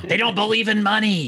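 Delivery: high, mocking voice; mock outraged American. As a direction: scoff like a scandalized patriot. Mocking the anti-communist view.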